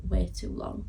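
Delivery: usual speaking voice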